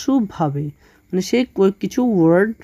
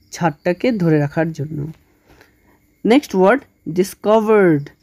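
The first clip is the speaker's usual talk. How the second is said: ordinary delivery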